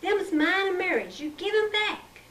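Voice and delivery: high, insistent, near shouting; high-pitched angry older sister; angry demand. A narrator's reading. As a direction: demand them back.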